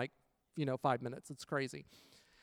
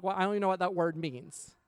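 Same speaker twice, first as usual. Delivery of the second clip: normal talking voice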